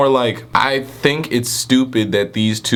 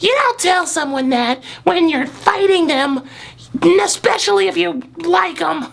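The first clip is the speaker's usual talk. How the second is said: high woman voice. Acted. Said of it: "and she's like".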